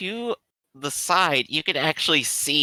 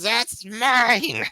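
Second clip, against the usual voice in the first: high shrieking creature cry; creature scream; 11 semitones higher than usual. Acted. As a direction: shriek it like a tiny greedy monster. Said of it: "it screams".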